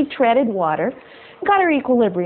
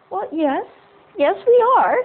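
lightly raised, prim talking groundhog; a haughty little animal voice, a touch higher; 8 semitones higher than usual. Acted. Groundhog answers fisherman.

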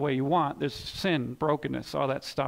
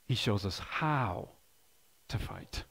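slow, fading down to a whisper on to fight; slow emphatic repeat; 7 semitones lower, 7 dB quieter, slower than usual. Shown as a demonstration. Pastor repeating the point to his congregation, slow and fading. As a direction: let it sink in, trailing off into a whisper.

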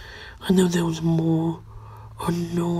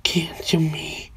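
evil voice; 4 dB quieter than usual. Acted. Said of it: "this is my evil voice".

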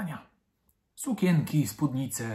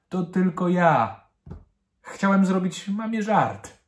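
higher, light-hearted explanation; cheerful reply; 6 semitones higher, 3 dB louder than usual. A narrator's reading. Dad explaining his joke to his daughter, cheerfully. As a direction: own up merrily to the prank. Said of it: "odparł wesoło tata".

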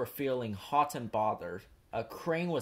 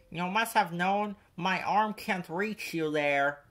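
higher, whiny grumble; complaining; 6 semitones higher than usual. A narrator's reading. Crane complains to Thomas, whining. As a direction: moan as if wronged. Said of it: "complained Cranky".

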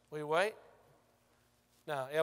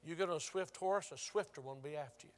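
usual speaking voice

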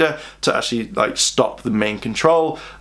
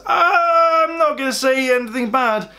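crying shout; 12 semitones higher than usual. Retold or imitated. Chase crying out to the shopkeeper, tearful high shout. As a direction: blurt it out through tears.

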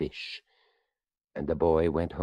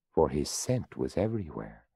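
ordinary delivery

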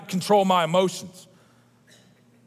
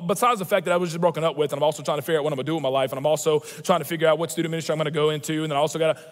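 rushed rambling; 3 semitones lower, much faster than usual. Retold or imitated. Rushed list of worries to doctor.

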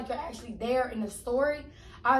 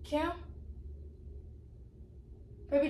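normal talking voice

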